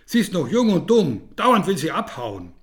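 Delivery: put-on loud, high call to the children; calling over. A narrator's reading. Boy calls to the children, loud explaining call. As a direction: call out an excuse. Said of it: "Den Kindern ruft er zu".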